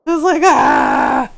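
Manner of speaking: slow loud frustrated aah; frustrated cry. Real, not acted. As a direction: let out a drawn-out loud groan of frustration. Frustrated outburst.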